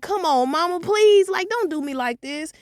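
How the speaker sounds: high whiny begging; whining plea. Retold or imitated. Daughter begging mom, high and whiny. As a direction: beg like a tired kid. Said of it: "I'm like".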